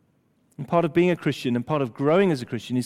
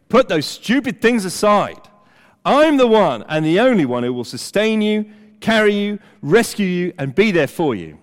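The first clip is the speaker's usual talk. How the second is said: loud, higher-pitched voice of God insisting; very emphatic; 4 semitones higher, 6 dB louder than usual. Acted. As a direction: drive each promise home forcefully. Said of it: "Very emphatic. He's saying".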